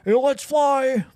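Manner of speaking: high, loud, slow, drawn-out commentator cry; commentator catchphrase. Retold or imitated. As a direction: belt it out like a football commentator. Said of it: "some of his quotes were".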